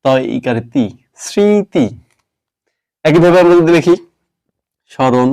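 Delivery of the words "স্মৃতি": স্মৃতি voiced as srriti with a nasal i; teacher sounds out স্মৃতি with no m; demo pronunciation. Shown as a demonstration. Teacher models স্মৃতি for students after spelling it. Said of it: "সুতরাং স্মৃতি এর উচ্চারণ লিখতে হবে এভাবে".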